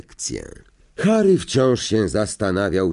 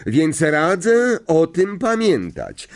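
high, slow, emphatic announcement; stern teacher; 8 semitones higher than usual. A narrator's reading. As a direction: lay down the rule to the class. Teacher instructs the whole class. (dobitnie, tonem nauczycielki)

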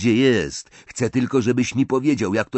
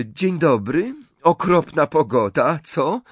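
higher, louder chirpy greeting; brisk, cheery; 9 semitones higher, 6 dB louder than usual. A narrator's reading. Hermione greeting the suspicious shopkeeper brightly. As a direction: breeze in sounding carefree. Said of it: "rozległ się dziarski głos Hermiony".

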